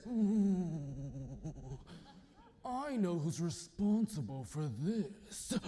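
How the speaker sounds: a hint of a sinister king; menacing king. Angry king realizes.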